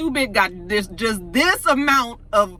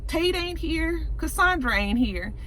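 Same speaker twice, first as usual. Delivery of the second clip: dawning suspicion; 10 semitones higher, 4 dB quieter than usual. Retold or imitated. Retelling the aide working out the affair, suspicious.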